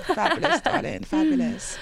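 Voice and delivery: high, theatrical darling voice. Pretend. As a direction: ham it up like a diva. Camp echo of fabulous.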